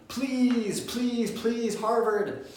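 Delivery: high, drawn-out mock pleading; begging. Applicant imploring Harvard to admit them, mock begging. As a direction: plead desperately, stretching each word up high. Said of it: "a tone of begging".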